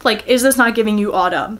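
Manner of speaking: ordinary talk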